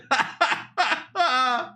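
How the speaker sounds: squealing laughter; cracking up at being roasted; high helpless laugh. Real, not acted. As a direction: laugh until it almost cries.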